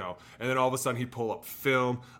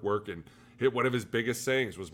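ordinary talk, nothing marked

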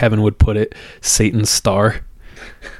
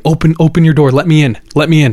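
higher, urgent retold pleading; insistent imitated begging; frantic demand; 6 semitones higher, 3 dB louder, faster than usual. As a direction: act out a woman desperate to get inside. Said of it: "she's frantic".

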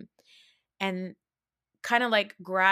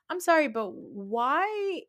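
incredulous; 8 semitones higher, slower than usual. Real, not acted. Incredulous rant at cast.

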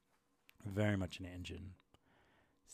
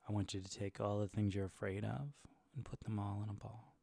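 guided meditation. Acted. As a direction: calmly guide the listener. Ball meditation.

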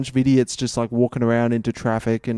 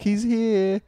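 higher teasing voice; 9 semitones higher than usual. Retold or imitated. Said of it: "you're like".

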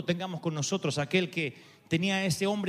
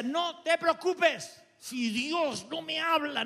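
stubborn reply; 9 semitones higher, much slower than usual. Retold or imitated. Pastor answers worried wife.

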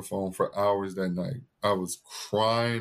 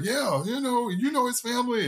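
very high, offhand mock dad; evasive dad. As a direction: toss it off in a high dodging voice. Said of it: "my dad was like".

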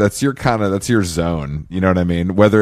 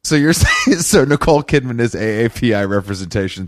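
normal talking voice

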